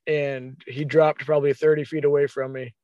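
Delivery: normal talking voice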